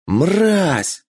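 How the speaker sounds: furious high roar; berserk rage. Acted. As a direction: spit it out in rage. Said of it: "в ярости проорал Юдо".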